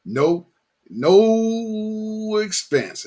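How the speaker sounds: slow drawn-out stoner voice. Retold or imitated. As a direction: stretch it out, dazed and slow. Drawn-out movie quote.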